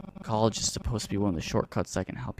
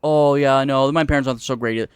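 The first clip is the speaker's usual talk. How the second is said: mocking quote; 3 semitones higher, 8 dB louder than usual.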